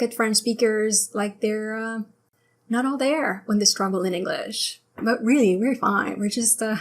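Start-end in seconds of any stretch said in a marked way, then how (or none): none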